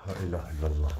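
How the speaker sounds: voiceless low La ilaha illallah; quiet protective whisper; whispered prayer. Real, not acted. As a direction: breathe La ilaha illallah out softly.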